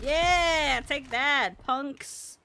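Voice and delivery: high, loud victorious gloat; triumphant taunt. Pretend. Player to beaten punks, triumphant taunt. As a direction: crow over beaten foes.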